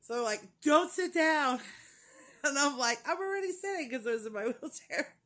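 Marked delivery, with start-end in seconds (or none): 0.6-1.6 loud, high-pitched retold command; mock urgent shout. Staff jokingly calling out to her. 3.1-3.9 high-pitched, amused protest; retold comeback. Her comeback to the staff.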